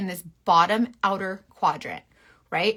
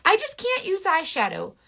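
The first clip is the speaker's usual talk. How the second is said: high, put-on helpless voice; whiny viewer impression; 12 semitones higher, 3 dB louder than usual. Mimicking viewers who give up on eyeshadow, high and whiny. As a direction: whine it like a frustrated beginner. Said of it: "that's why people say".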